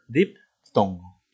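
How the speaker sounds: "diptongo" broken into dip, tongo; teacher's emphatic break inside "diptongo" after dip; split syllables. Shown as a demonstration. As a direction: stop after "dip", then finish "diptongo". Teacher spells out term by syllable.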